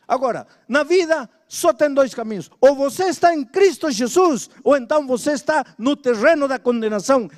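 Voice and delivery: pregação enfática; preaching at full force. Acted. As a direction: press the congregation to choose. Preacher pressing the choice.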